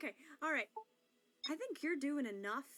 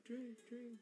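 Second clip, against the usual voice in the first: fading echo; 4 semitones lower, 11 dB quieter than usual. Pretend. Echo effect on cue.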